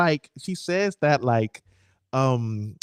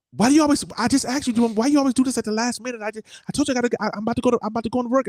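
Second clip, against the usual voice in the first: high-pitched, louder nagging-wife impression; imitating wife; 12 semitones higher, 4 dB louder, faster than usual. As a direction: mimic a wife's pestering, pitched way up. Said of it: "this is how she say I'm being mean".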